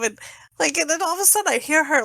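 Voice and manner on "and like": ordinary talk, nothing marked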